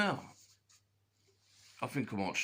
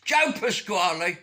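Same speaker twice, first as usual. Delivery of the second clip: loud exclamation; 7 semitones higher, 7 dB louder than usual. Acted.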